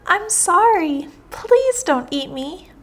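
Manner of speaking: pleading cry. A narrator's reading. Caught mouse begs the lion not to eat it, pleading cry. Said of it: "cried the mouse".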